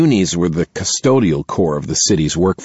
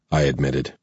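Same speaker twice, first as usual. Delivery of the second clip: ordinary delivery, nothing marked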